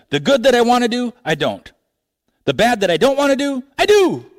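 Paul venting, the last words shouted high; frustrated outburst. Retold or imitated. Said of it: "this is frustrating".

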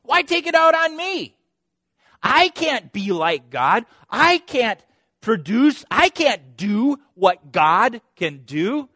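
high, loud, slow indignant shout; bold Jacob. Acted. Jacob boldly blaming God to Rachel, loud indignant shout. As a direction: throw the blame back at God. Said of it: "he's a little more bold".

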